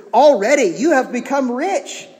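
loud, high mock congratulation; sarcastic. Paul to Corinthians who think they're rich, sarcastic. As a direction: proclaim it with exaggerated fake awe. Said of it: "how you know it's sarcasm".

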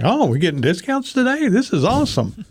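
pitched high, gleefully naive; mock delight. Customer delighted at the drive-thru window, high and gleeful. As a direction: play it like a happy clueless customer.

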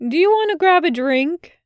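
a touch of hopeful lift. Acted. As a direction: ask him out for a drink, eagerly.

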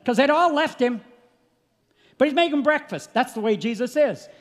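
high, loud revival preaching; preaching at full force. Acted. Preacher to congregation about Jesus praying, preaching at full force. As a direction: preach it out to the church, full throat.

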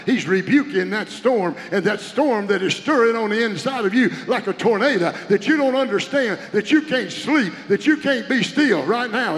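loud, rising fervent preaching; full-force preaching. Acted. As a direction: carry them to a shout of praise.

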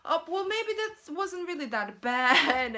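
high, airy brush-off, mock carefree; breezy dismissal. Voicing the forgetful self talking itself back, breezy high brush-off. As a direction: shrug it all off in a lilting voice. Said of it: "You start thinking like".

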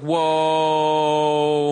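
long held, sung-out whoa; drawn-out imitation of an awed reaction; mock amazement. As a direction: stretch it out on one note. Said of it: "and you're like".

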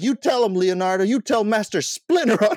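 high, loud passer-by egging someone on, hammed-up; heckling homeless guy. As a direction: imitate a street heckler, building to a laughing shout. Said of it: "this homeless guy walks by, is like".

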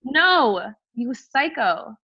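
retold indignant put-down; pissed off. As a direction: shut him down, stretching the words. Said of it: "I was so pissed".

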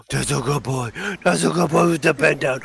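put-on high, loud pet-owner baby talk; gushing dog praise. Handler rewarding the president like a dog, gushing high praise. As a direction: squeal praise as if to a puppy.

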